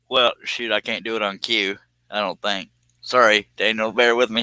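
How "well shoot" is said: ordinary talk, nothing marked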